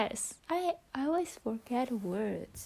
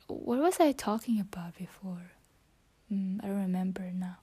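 whispering; 2 semitones lower than usual. Real, not acted. Whispering her English practice into the phone. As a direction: keep it to a whisper throughout.

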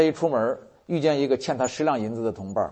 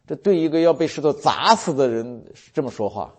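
usual speaking voice

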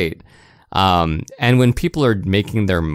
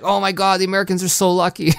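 higher, fast gushing envy; mock envious Japanese gamer; 10 semitones higher, faster than usual. Imagined Japanese gamer envying Americans, higher and fast. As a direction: gush like a jealous kid reading a magazine. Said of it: "the Japanese are like".

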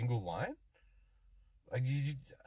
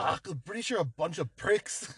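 higher, hammed-up Scottish accent; 4 semitones higher than usual. Co-host shows his way into character, Scottish accent. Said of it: "a Scot, I have to say".

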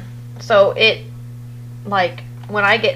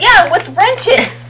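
high, slow, mocking agreement; wife mocking husband's rule; sarcastic drawl; 11 semitones higher, much slower than usual. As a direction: stretch it out sarcastically. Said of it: "And I said".

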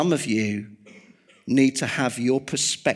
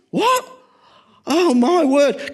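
astonished Thomas; 13 semitones higher, 8 dB louder, slower than usual. Retold or imitated. Thomas hears he missed Jesus.

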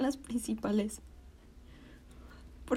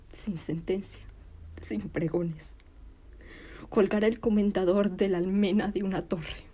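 ordinary talk, nothing marked

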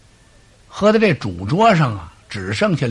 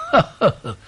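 cold laugh; 3 semitones higher, faster than usual. Acted. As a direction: chuckle coldly at the caught traitors. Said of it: "庞统冷笑了一声".

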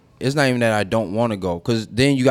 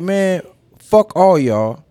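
slow, drawn-out defiant curse; inner defiance; 6 semitones higher, much slower than usual. Retold or imitated. Teen fed up with church adults, slow defiant curse. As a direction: stretch it out, fed up. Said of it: "that's what my soul was saying".

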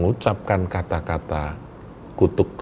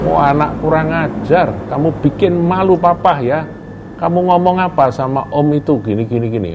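much higher and louder, ranting at the son; hammed-up angry father cursing his son; father storming in to berate his grown son, shouting; furious yelling father; 10 semitones higher, 5 dB louder than usual. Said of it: "marah-marah, maki-maki".

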